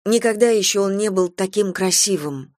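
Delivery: usual speaking voice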